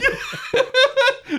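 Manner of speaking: weird high laugh. Real, not acted. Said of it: "this, like, weird laugh".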